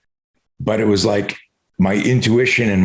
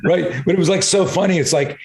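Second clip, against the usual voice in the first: usual speaking voice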